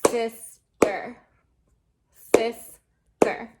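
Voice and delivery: "Sister" split clearly into sis and ter, a beat on each; teacher clapping out the syllables. Shown as a demonstration. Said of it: "Let's clap it out".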